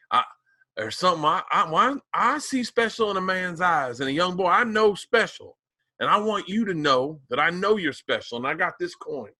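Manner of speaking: lightly imitating an old Southern man's accent. Comedian imitating an old Southern man praising him, light Southern accent. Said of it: "I wanna do it in his accent".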